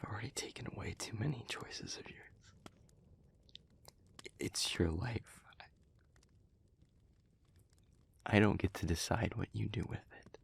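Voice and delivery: very soft, near-whispered resignation; subdued pleading. Acted. Speaker promising not to stop the listener, hushed resigned plea. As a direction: yield quietly, with long heavy pauses. Gives in, begs to reconsider.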